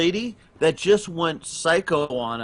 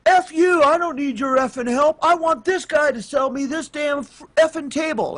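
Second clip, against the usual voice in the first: high, loud, mocking fury; mock angry old lady; 11 semitones higher, 4 dB louder than usual. Old lady customer cursing at the furniture staff, in a loud, high mock scream. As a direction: play the customer screaming at the clerks. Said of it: "And she goes".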